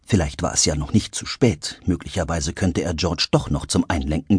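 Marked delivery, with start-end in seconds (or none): none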